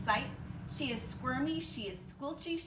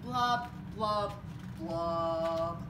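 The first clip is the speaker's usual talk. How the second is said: blub, blub, blub sinking lower each time; gloomy fish blubs; 6 semitones lower, much slower than usual. Acted. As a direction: let each blub drop deeper, glum. Fish's refrain, sinking gloomy blubs.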